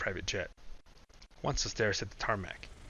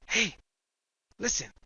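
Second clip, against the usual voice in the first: sharp call; 11 semitones higher, 4 dB louder than usual. Acted. Kai cuts Brian off sharply.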